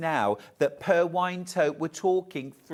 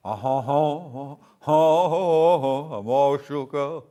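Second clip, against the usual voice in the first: [name] impression; 2 semitones lower, 7 dB louder, slower than usual. [name] look, singing.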